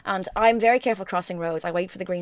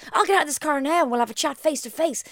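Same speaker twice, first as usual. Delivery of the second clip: retold ranting threat; 7 semitones higher than usual. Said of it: "the guy was screaming and ranting".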